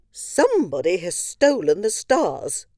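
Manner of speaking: high loud royal lament; a queen crying out in distress. Acted. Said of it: "cried Queen Moo".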